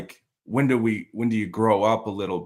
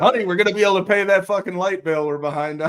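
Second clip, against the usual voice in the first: mock spouse voice; 6 semitones higher than usual. Mock voice of grateful wife.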